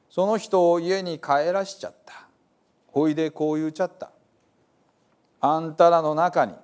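Gospel told in lively Hiroshima dialect; dialect story reading. Acted. Reading the Bible to listeners as a dialect storyteller. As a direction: tell it like a hometown storyteller.

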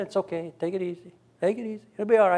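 soft, unhurried take it easy; calm reassurance. Retold or imitated. Lifeguard calmly reassuring the worried buddies, soft. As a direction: brush them off softly.

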